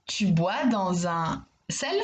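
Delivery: slow, mock-puzzled question, rising on sel; doubtful wrong-answer question. Shown as a demonstration. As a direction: sound it out as an obviously absurd question. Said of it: "je dois simplement choisir les mots pour donner du sens". (question faussement étonnée, lente, montée sur sel)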